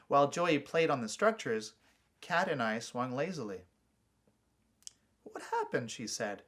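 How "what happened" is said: Kat, concerned. A narrator's reading. Sister asks sulking brother.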